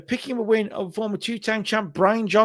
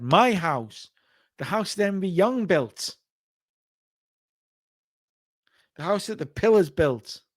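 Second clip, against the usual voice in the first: proud wrestler promo; 4 dB louder, slower than usual. Retold or imitated. Retelling M V Young promo.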